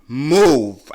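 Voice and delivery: loud barked order; hooting at a driver. Retold or imitated. Driver blocking the road, loud hoot of an order. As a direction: shout it at the car. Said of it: "I hooted at her".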